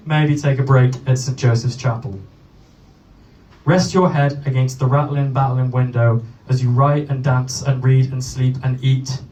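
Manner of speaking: measured, projected poem recital; poetry reading. Acted. Poet reads a travel poem to a gig crowd, poetry reading. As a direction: recite it live, line by line, with deliberate pauses.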